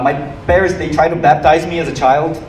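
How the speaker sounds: ordinary delivery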